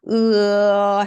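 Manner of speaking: long "으" opening gradually into 어; gliding vowel. Shown as a demonstration. Teacher demonstrating for learners, "으" gliding slowly to 어. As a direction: hold "으" and let it slide to 어. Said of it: "입을 으에서 어로 천천히 벌리면서".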